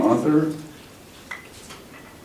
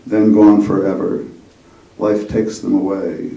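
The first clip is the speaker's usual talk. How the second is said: poem reading; 8 dB louder than usual. Acted. Poet reading to a gathering, measured recital. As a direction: read your poem to the room, letting each line land. Reads own poem aloud.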